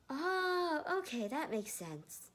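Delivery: high sheepish oh, then slow; embarrassed but relieved; sheepish teen. Acted. Matthew understanding Ned, high and sheepish. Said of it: "Matthew smiled sheepishly".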